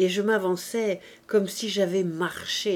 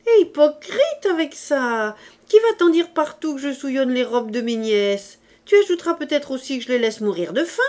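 loud, higher, accusing aunt; offended aunt; 6 semitones higher, 6 dB louder than usual. A narrator's reading. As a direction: pile on indignant accusations. (tante outrée qui accuse)

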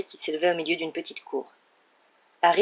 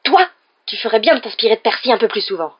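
raised, higher, louder retort; angry cry; 4 semitones higher, 8 dB louder than usual. A narrator's reading. Mother rebuking Fred over Percy, angry cry. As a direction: round on him sharply and loudly. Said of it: "s'écria madame Weasley".

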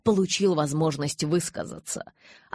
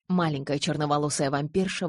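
plain reading voice

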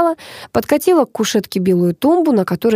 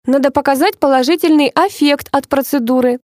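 higher, chirpy beautician; chirping; 7 semitones higher than usual. A narrator's reading. Cosmetologist promises results from the procedure, chirping. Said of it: "щебетала косметолог".

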